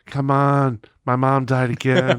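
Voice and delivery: drawn-out pleading boss drone; imitated former boss. Boss reusing his excuse on employee, droning comic imitation. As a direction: plead in the boss's stretched voice. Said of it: "he used to talk like this".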